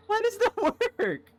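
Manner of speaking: very high, loud squeal through laughter; laughing disbelief. Real, not acted. Gamer who made alcohol from two knives, laughing in disbelief. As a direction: crack up while getting the words out. Cracking up at absurd alchemy result.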